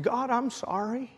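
mildly tearful, re-enacted apology; retold tearful prayer. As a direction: pray it as if starting to cry. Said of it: "And I started crying".

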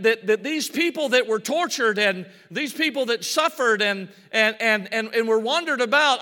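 usual speaking voice

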